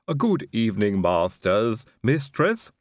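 slow, lisping servant drawl; a creepy, lisping manservant; Igor's voice. A narrator's reading. Igor greets the guests.

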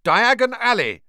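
loud high shout. A narrator's reading. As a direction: call it out into the fire.

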